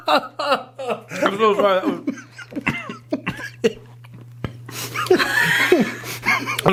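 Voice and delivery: laughing through the words; slow, giggling apology. Real, not acted. Laughing fit over sour candy.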